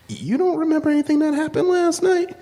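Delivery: high, disbelieving question from the doorway; incredulous friend. Retold or imitated. Friend at the door asking if he remembers, incredulous. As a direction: play the stunned buddy who can't believe it.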